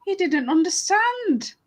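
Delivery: high-pitched, emphatic narration; animated tale-telling; sympathetic storytelling. Acted. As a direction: plead the poor man's case to young listeners. Narrates poor man's confusion.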